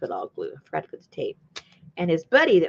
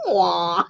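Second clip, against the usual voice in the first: mock gasp; 4 dB louder than usual. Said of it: "his eyes go".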